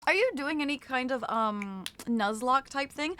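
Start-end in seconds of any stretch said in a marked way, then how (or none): none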